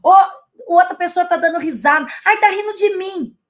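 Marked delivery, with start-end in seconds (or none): 2.2-3.4 higher, hammed-up wounded whine; mock paranoid outburst. Imitating a coworker who thinks others laugh at her, mock higher whine. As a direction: play the insecure colleague, rising to a yelp.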